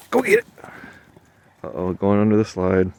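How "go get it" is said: much higher, louder go get it; excited dog command. Real, not acted. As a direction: fire the dog off after the ball. Throwing ball for dog.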